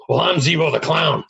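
punchline in a put-on stranger's voice; playful mock announcement; imagined stranger announcing himself. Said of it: "And they'll say".

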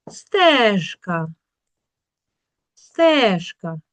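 "стежка" with a punched, stretched Е; stress on Е. Shown as a demonstration. Teacher repeating the check word, stressed Е. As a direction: hit the first syllable of "стежка". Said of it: "під наголосом чуємо".

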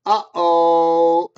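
put-on loud, drawn-out uh-oh for the children; playful warning uh-oh; mock alarmed uh-oh. Reading aloud to children.

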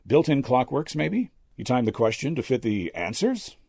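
high, probing magician's questions; brash magician. A narrator's reading. Magician quizzes the old medium.

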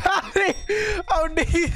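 talking through laughter; words broken by laughs; laughing hard. Real, not acted.

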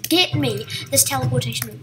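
ordinary talk, nothing marked

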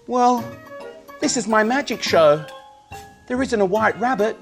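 loud, high showman patter; kids party magician. Acted. Magic show for children.

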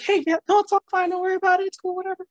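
very high-pitched retold brush-off; mock breezy reassurance. Replying to an apology for dad, mock breezy reassurance. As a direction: wave off an apology in a high, imitated voice.

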